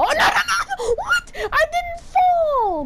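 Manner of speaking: high, loud, drawn-out cry of protest; desperate yell. Real, not acted. Player yelling at friends that he didn't fall, loud drawn-out cry. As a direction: wail it out slowly as you lose. Falls in game, protests loudly.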